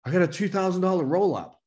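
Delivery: lightly voiced worried manager; mocking a fretting manager. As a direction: imitate a manager making a fuss. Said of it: "Or an, a manager".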